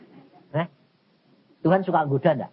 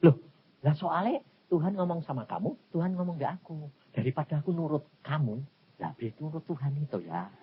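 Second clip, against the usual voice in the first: soft reply; 8 dB quieter than usual. Retold or imitated. Soft reply to angry prophet.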